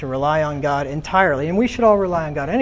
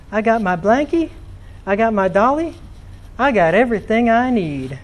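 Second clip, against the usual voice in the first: lightly voiced small child, a touch higher; little Sophie, three years old; 4 semitones higher than usual. Preacher quoting three-year-old Sophie at the campout, lightly childlike. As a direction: chirp it like a content toddler in her camping chair. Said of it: "And she says".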